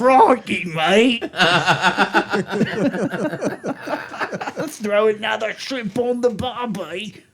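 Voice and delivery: high, badly faked Australian accent; worst Australian accent. Retelling Annie's card-game attempt, imitating a terrible Australian accent. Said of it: "the worst Australian accent".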